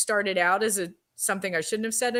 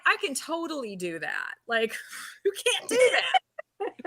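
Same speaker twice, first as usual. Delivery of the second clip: mock confidence cracking high at the end; faking cool; 6 semitones higher, 3 dB quieter than usual. Actress insisting she can handle spiders, badly faking cool. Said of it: "I'm trying to be Miss, Miss Cool".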